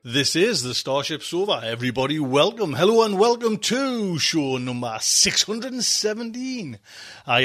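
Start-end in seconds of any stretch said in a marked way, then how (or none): none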